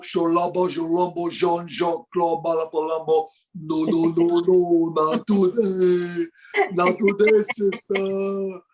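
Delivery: put-on thick African-accented caricature, sustained and droning; Dikembe Mutombo. African center refusing a shot, big booming caricature. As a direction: stretch the last words in a big mock player voice. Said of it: "the big, deep voice".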